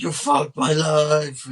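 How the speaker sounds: loud, high, drawn-out furious imitation; angry mock yell. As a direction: belt it out slowly, parodying his rage. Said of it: "he's very angry for sure".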